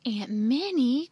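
loud, drawn-out protest; crying out. A narrator's reading. Andy loaded down by his aunt, crying out. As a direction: wail her name slowly and loudly. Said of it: "cried Andy".

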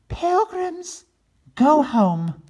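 very high loud turkey voice; turkey sign. Acted. Regina's sign read to the pilgrims in very high loud voice. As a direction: taunt the pilgrims.

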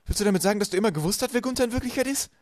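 loud, high-pitched disbelief, rising to the end; aghast. A narrator's reading. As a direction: demand the truth in shock. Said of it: "fragte Violet entgeistert". (entgeistert und laut nachfragend)

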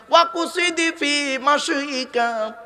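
slow, high chanted Arabic; Quranic recitation. Acted. Preacher quoting the Quran to his audience, slow and chanted. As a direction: stretch the verse out like a recitation.

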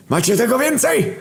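Rusty's voice. Acted. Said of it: "zapytał Rusty Warrior".

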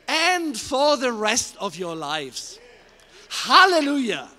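building, rising pulpit fervour up to a loud Hallelujah; fervent preaching. Real, not acted. Preacher rousing the congregation, full-force preaching. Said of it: "I'm now lowering the temperature".

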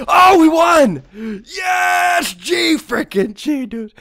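high, jubilant yelling, drawn-out cheer; victory shout. Real, not acted. Gamer winning the round, jubilant shout. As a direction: celebrate at the top of your voice. Wins the match.

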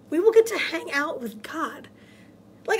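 ordinary talk

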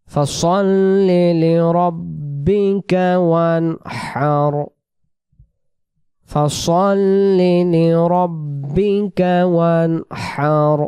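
very slow chanted recitation; drawn-out melodic tajweed; Quran recitation. Shown as a demonstration. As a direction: recite the verse, stretching each word. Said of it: "প্রথম আয়াত".

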